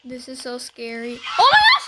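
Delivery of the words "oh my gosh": very high, very loud shriek of fright; startled scream. Real, not acted. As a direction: scream as if something just jumped out. Sudden scare in horror game.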